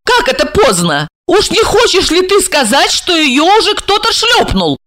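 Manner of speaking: high-pitched, mocking questions; incredulous woman. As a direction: question him in high-voiced disbelief. Plays Regina, incredulous.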